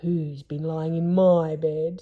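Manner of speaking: slow, lightly growled father bear; a hint of a big gruff bear; growly bear. Father Bear rumbling over his bed. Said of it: "in a growly voice".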